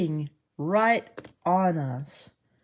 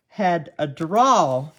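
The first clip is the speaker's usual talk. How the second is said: slow, louder Southern drawl; 6 dB louder, much slower than usual. Shown as a demonstration. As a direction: draw "drawl" right out, Southern style. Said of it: "She had a drawl".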